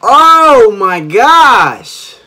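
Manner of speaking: slow, loud oh my gosh; drawn-out awe. Real, not acted. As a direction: stretch it in amazement. Awed by dance.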